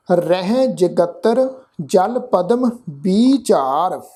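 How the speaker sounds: ਰਹਿ said with a nasal vowel, whole line slow; nasal rahi. Shown as a demonstration. Teacher modelling the nasal ਰਹਿ for the congregation. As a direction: recite ਰਹਿ nasalised, then the line slowly. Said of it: "ਨਾਸਕੀ ਪਾਠ ਉਚਾਰਨ ਕਰਾਂਗੇ ਜੀ".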